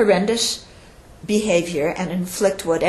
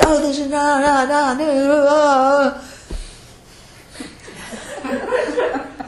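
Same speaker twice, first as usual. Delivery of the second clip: mock scolding; 5 semitones higher, 5 dB louder than usual. Acted scolding of a partner.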